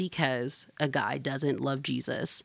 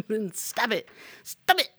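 exasperated scolding; 7 semitones higher than usual. Real, not acted. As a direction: scold the viewer sharply. Scolding ex-snooping viewers.

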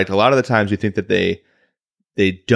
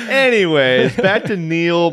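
laughing; 6 semitones higher, slower than usual. Real, not acted. Laughing off a tangent.

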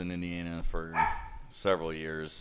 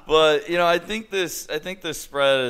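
laughing loudly, higher pitch; 10 semitones higher, 9 dB louder than usual. Real, not acted. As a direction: laugh through the words. Laughing at joke.

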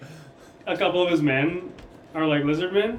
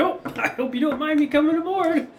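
high, laughing pirate charm; breezy high voice of a charming rogue; old sailor; 11 semitones higher than usual. Acted.

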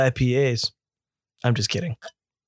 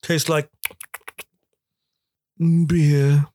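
lightly voiced snooty taster; pretentious beer snob; 2 semitones higher, much slower than usual. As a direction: savor each word like a wine snob. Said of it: "Like a pretentious beer snob".

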